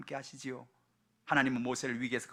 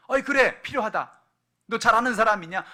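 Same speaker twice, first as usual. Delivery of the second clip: much higher and louder regal reply; grand princess; 9 semitones higher, 15 dB louder than usual. Acted. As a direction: answer like a lofty princess. Princess answers the girl grandly.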